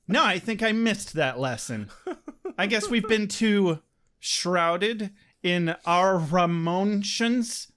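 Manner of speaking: ordinary talk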